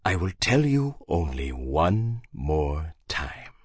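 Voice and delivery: low, quiet, slow menace; stern captain. A narrator's reading. Hagbard conditioning Harry, low slow stern captain. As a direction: hold him still with quiet threat. Hagbard voiced lower and softer.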